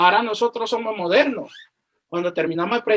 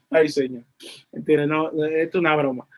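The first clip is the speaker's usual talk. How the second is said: ordinary talk, nothing marked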